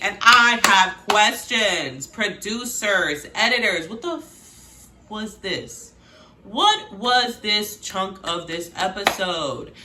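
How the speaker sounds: slow, drawn-out emphatic outrage; loud start, words spaced out, incredulous; indignant disbelief. Real, not acted. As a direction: stretch each word in baffled indignation. Host indignant at the editing.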